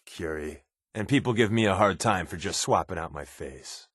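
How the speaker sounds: ordinary talk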